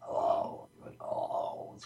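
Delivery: whispery, groggy man's moan; voiceless groggy "oh". Retold or imitated. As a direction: imitate a creepy groggy voice, all breath. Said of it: "a real groggy voice".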